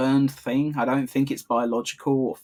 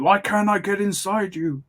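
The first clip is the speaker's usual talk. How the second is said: higher, put-on mock-complaining voice; imitating frustrated alien; 6 semitones higher than usual. Podcaster retells the alien trying to possess Superboy, mock frustrated voice. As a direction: mimic the thwarted alien, pitched up. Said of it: "He's like".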